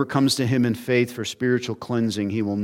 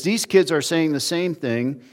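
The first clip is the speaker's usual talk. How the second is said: higher, insistent mock outrage; indignant demand; 5 semitones higher, 5 dB louder than usual. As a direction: demand he stop it, mildly hammed up. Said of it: "what they're really saying is".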